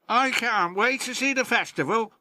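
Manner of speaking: small, high, quick engine voice; a little engine bursting with excitement; peeping Percy. Acted. Percy telling his friends about the festival, high excited peep. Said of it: "peeped Percy".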